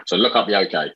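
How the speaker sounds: normal talking voice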